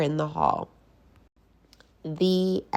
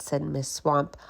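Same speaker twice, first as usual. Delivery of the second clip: ordinary delivery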